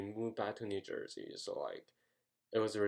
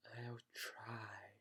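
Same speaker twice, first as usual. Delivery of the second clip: mimicked agent in a drawn-out whisper; slow-talking agent; 13 dB quieter than usual. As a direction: breathe it out slowly, barely audible. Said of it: "He talked so slow. He was kinda like this".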